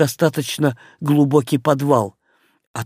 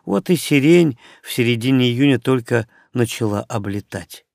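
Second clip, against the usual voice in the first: ordinary delivery, nothing marked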